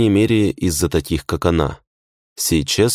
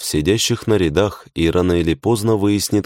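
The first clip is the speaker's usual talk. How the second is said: ordinary delivery, nothing marked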